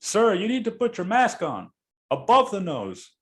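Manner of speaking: high mock yell at the passenger; retold yelling. Bus driver yelling at the narrator, high mock shout. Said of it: "He's yelling".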